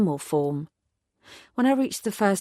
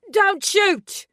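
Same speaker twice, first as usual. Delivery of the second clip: much higher, loud panicked yell; terrified yell; 14 semitones higher, 5 dB louder than usual. A narrator's reading. Tourist yelling from the bus door, terrified. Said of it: "he yelled".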